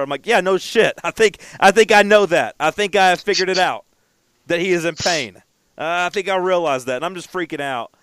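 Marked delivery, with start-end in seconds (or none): 0.3-7.0 raised, louder, exasperated comeback; sarcastic retort. Retold or imitated. Owner snaps at vet tech's obvious diagnosis, sarcastic. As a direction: retell it as a heated "obviously".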